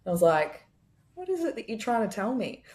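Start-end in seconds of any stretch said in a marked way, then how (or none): 1.1-2.6 higher, open questioning tone; curious. Retold or imitated. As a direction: ask your own skin gently, truly wanting to know. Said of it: "It was actually just curious".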